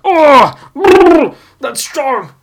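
high, loud "brr" then a gasp; loud shiver. Retold or imitated. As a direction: act the jolt of strong coffee. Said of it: "You're like".

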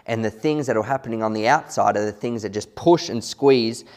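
ordinary talk, nothing marked

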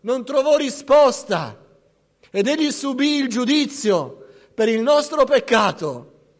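loud, high, urgent sermon delivery; fiery preaching. Acted. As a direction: preach it out to the whole congregation. Preacher at full force.

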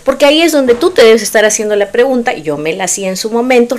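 ordinary talk, nothing marked

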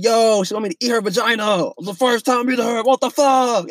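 retold stunned reaction to a shocking text; shocked disbelief. Telling friends about the text's meaning, shocked disbelief. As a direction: voice jumps high, ending in a half-shout.

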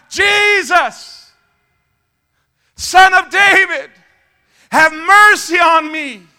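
high, loud, drawn-out plea; crying out. Acted. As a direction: cry it out slowly to someone passing by. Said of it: "So he cries out".

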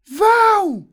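one last piercing shriek; loud, strident high-pitched yell; Dona Florinda screaming. A narrator's reading. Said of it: "Dona Florinda deu seu último grito".

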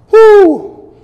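strained high whoop from the effort; loud high whoop. Real, not acted. Cheering while holding a plank.

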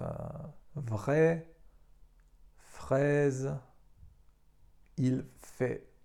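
"vrai", "fraise", "il fait" with AI as an open E; teacher showing AI; French pronunciation. Shown as a demonstration. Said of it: "tak jak w słowach".

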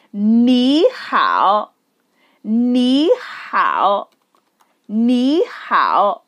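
"Ni hao" drawn out, rising then dipping; model pronunciation. Shown as a demonstration. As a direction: stretch "Ni hao" syllable by syllable for learners. Teacher models greeting for repetition.

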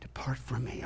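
hushed sorrowful whisper; preacher redoing Jesus's words sadly. Shown as a demonstration. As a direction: say it softly with sadness. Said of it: "I think there'd be more sadness in it".

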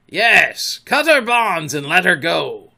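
forceful shouted command; commanding pirate captain. A narrator's reading. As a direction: bellow it as an impatient captain. Fake Hook orders the pirates.

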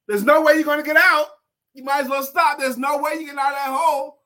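high, loud taunting; frogs jeering down the hole. Acted. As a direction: call down the hole that it's hopeless. Other frogs mock from above.

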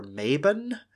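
Mabon said as MAY-bun, weak second vowel; mistaken Mabon pronunciation. Shown as a demonstration. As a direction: say Mabon with long "ay", reduced ending. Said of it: "mistakenly pronounced as Mabon".

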